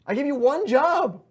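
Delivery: loud, high, fed-up scolding; exasperated yelling. Acted. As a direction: yell at the useless minion. Scolding a skeleton minion.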